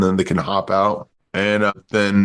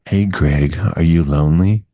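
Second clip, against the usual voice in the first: late-night sex ad; 5 semitones lower than usual. Retold or imitated. Host mimics a late night sex ad for his co-host, sultry ad voice. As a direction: imitate a late-night phone-line pitch. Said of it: "remember they had those late night sex ads".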